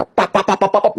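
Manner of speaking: quick popping pap sounds like shots; playful imitated rifle fire; mock gunfire. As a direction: rattle off the shots in a burst. Imitating soldiers' gunfire again.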